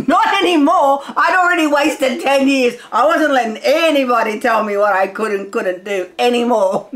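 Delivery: worked up, laughing defiance; fired-up pep talk with laughter breaking in; excited. Real, not acted. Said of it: "I do get a little excited".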